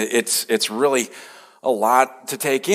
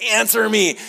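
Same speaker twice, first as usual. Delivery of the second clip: God demanding; 10 semitones higher than usual. Retold or imitated.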